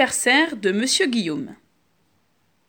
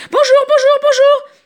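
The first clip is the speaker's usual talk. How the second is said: excited greeting; 6 dB louder than usual. Acted. Cook greets guests excitedly.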